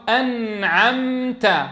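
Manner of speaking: أنعمت very slow, the nun resting still; slow clear nun. Shown as a demonstration. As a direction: stretch أنعمت so every sukun is heard. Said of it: "ثم ضرب مثالا آخر".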